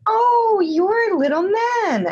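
high, slow, breathy-free princess coo. Retold or imitated. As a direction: coo it like a wide-eyed princess.

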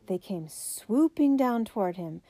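ordinary delivery

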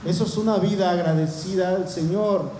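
normal talking voice

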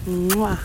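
blown kiss. Real, not acted.

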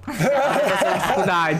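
mock-pitying line with a laugh through it. Carol mimics coworkers pitying her lunchbox, laughing. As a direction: giggle out the coworkers' fake concern. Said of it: "O povo falava".

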